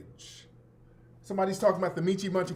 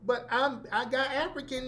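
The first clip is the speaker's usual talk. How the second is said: high, softer mock Southern plea; lightly mocking Southern voice; fake Southern inflection; 5 semitones higher, 5 dB quieter than usual. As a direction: imitate a pleading objector with Southern inflection. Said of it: "your fake Southern voice inflections".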